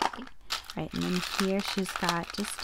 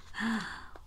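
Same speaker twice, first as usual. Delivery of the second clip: soft gasp of amazement; awed gasp; 8 dB quieter than usual. Real, not acted. Crafter discovering more in the gift box, awed gasp. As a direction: catch your breath in wonder.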